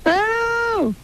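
very high, drawn-out hellos; high-pitched hail into a dead line; calling down the line. Real, not acted. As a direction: send each hello way up high. Caller seems to have gone.

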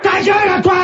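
high sharp shout; snapping shut up. Acted. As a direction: snap at the heckler. Host snaps at a heckler. (crié, aigu, agacé)

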